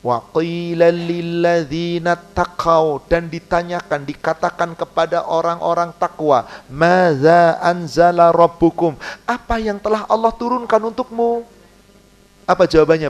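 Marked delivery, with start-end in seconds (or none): 0.0-3.1 slow chanted Arabic recitation; Quran recitation. Acted. Preacher reciting the verse to the congregation, slow chant. 6.7-9.0 slow chanted Arabic recitation; Quran recitation. Acted. Preacher reciting the verse to the congregation, slow chant.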